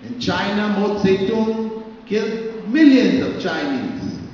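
normal talking voice

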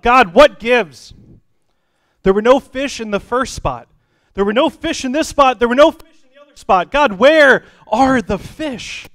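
loud, high-pitched protest to heaven; exasperated fisherman. Acted. Fisherman demanding answers from God, loudly exasperated. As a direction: cry out in acted exasperation, stretching the final question.

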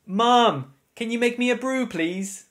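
put-on high-pitched child pleading for a brew; acted, high sing-out request to a parent; calling to mum.

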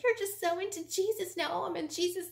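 high-pitched sneering mimicry; exaggerated, high mocking taunt. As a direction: make fun of his faith. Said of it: "like, kinda mocking him".

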